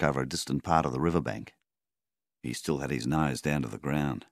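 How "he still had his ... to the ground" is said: ordinary delivery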